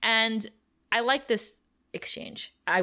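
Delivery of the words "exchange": whispering. Real, not acted. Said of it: "I would like this exchange".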